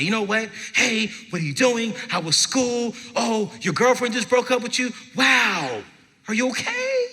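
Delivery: a lightly voiced cheerful father asking about school; caring dad voice.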